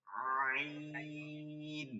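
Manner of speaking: আইন drawn long and soft, pulled inward into the throat; soft, held আইন from mid-throat; gentle guttural ain; correct throat ain. Shown as a demonstration. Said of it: "শুধু আইন".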